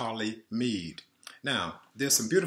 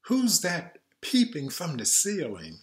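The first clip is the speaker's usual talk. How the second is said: sing-song read-aloud lilt; reading to children; 4 semitones higher, 3 dB louder than usual. A narrator's reading. Reading a lullaby book to kids, sing-song. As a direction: read it gently to a little one.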